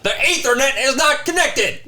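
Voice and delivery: high barking instructor voice; drill sergeant. Pretend. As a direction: chew out the recruit about the cable. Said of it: "all right, I'll stop that".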